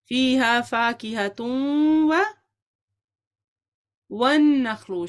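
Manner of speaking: very slow, drawn-out recital; prompting recitation. Shown as a demonstration. Teacher cueing students to continue the verse, drawn out. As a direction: stretch each syllable to cue the class.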